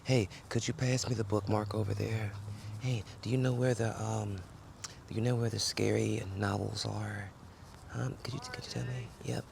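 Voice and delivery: hushed request so nobody hears; library quiet. Pretend. Asking a librarian for scary novels in a hushed voice. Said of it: "talking like I'm in a library".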